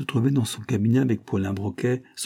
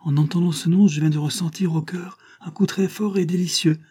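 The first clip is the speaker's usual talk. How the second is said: moved outburst; 8 semitones higher than usual. A narrator's reading. Geneviève to Fernand, overwhelmed and moved. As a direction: let the feeling break out. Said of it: "regardant tendrement Fernand".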